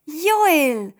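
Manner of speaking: laut und hoch gerufen; freudiger Ruf. Acted. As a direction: ruf den Namen vor Freude laut aus. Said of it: "ruft Miriam". (lauter, hoher Freudenruf)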